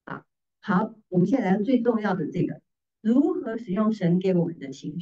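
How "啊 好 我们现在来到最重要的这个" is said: usual speaking voice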